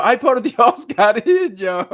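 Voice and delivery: high, loud cry of delight with a laugh; laughing cry of joy at a cheeky viewer comment. Real, not acted.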